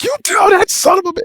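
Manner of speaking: high shouted curse; angry yell. Retold or imitated. Captain cursing during radio call.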